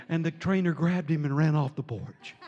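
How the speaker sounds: ordinary talk, nothing marked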